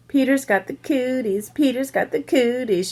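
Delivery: mock yell. A narrator's reading. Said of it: "she yelled".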